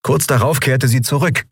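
plain reading voice

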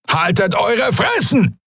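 high, slow, wired thug sneering at a prisoner; a guard hopped up on drugs, barking orders; drugged-up pirate. Acted. Guard to captive, high jittery drug-fuelled jeer.

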